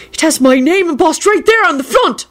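high-pitched indignant protest; angry shout. A narrator's reading. Parks proving the Bible is his, angry. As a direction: insist in outrage.

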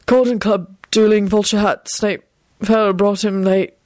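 silly, muddled nonsense; dazed announcement. A narrator's reading. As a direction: announce it as if concussed. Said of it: "announced and smiled in a rather dazed and silly fashion".

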